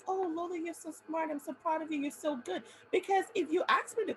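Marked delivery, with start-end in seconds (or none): 0.1-2.6 doting mentor. Retold or imitated. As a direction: gush like a proud teacher. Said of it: "She was like".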